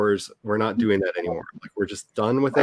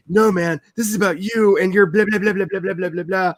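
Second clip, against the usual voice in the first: mocking seller refusal; 8 semitones higher than usual. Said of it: "Because it wouldn't sell to me".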